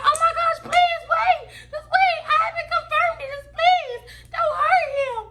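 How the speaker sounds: frantic laughing plea to her friend; panicked pleading. Retold or imitated. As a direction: beg him to stop, half laughing, half frantic. She begs friend not to hurt him.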